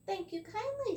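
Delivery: high, tiny leprechaun voice; squeaky leprechaun. Acted. The leprechaun thanks Tom in a tiny high voice. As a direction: pitch it way up like a wee fairy man.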